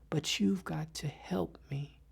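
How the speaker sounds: soft, half-whispered appeal; hushed plea. Acted. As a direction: plead for help almost under your breath. Hushed plea for help in poem.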